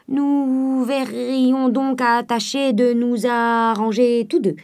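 slow, halting, drawn-out words; sly old miser drawing out his bargain; dragged stammering. A narrator's reading. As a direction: stretch it out like a cunning old man stalling. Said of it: "Le bonhomme ne bredouilla plus".

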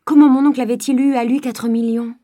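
loud, high disbelief; astonished outcry. Acted. Daughter loudly astonished at uncle's debt. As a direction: keep the shocked cry going. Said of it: "s'écria Eugénie".

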